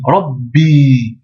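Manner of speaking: Rabbi with the b held before the i; doubled b. Shown as a demonstration. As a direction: block the b in Rabbi then release. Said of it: "Mais il y a une shedda".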